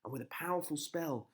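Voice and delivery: usual speaking voice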